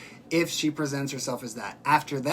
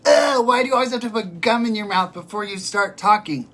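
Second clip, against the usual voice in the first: whiny mock commenter; 5 semitones higher, 7 dB louder than usual. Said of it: "somebody commented".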